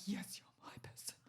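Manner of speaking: soft whispered yes, you're my person; whispered reply. Retold or imitated. Whispering back to a fan who hugs her. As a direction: breathe it back tenderly.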